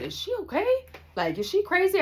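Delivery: very high-pitched disbelieving question; incredulous. Retold or imitated. Narrator asking about violent friend, incredulous. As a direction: ask it in high mock disbelief.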